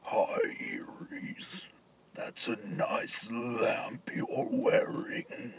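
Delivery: slow, hushed creepy whisper. Acted. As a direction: breathe it slowly and menacingly.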